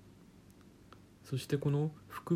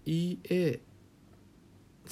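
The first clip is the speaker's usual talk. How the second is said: イーエー stretched very slowly and higher; drawn-out spelling; 7 semitones higher, much slower than usual. Shown as a demonstration. Teacher spells out the letters イーエー slowly. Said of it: "逆に言うとイーエー".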